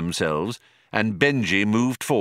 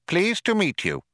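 mouse voice; 10 semitones higher than usual. A narrator's reading. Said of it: "The other mouse said".

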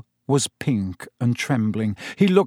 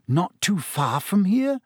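slow, rising Not too far from here?, heavy with meaning; meaningful repeat; 5 semitones higher, slower than usual. A narrator's reading. Boy repeating the news pointedly to his friend, slow and loaded. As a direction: echo it slowly, loaded. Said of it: "Ron repeated".

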